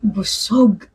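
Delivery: whispered to herself. Acted. Girl whispering to herself after eating. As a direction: breathe the word out unvoiced.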